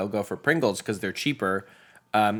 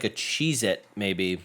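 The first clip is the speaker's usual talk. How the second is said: ordinary talk, nothing marked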